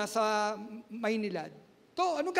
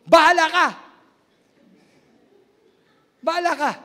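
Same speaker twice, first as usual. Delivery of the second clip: loud exasperated snap of "Bahala ka!"; angry mother; 4 semitones higher, 10 dB louder, faster than usual. Retold or imitated. Mother answering her son who was called a thief, loud angry snap. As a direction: throw it back in frustration. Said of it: "sagot ng mami ko".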